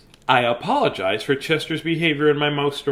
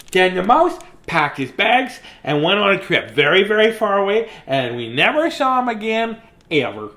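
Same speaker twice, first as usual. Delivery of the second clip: higher, put-on cheeky cat voice; playful cat character voice, pitched up; Chester the cat voice; 5 semitones higher, 4 dB louder than usual. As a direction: read it as the smug cat who wrote it. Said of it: "Chester needs a voice".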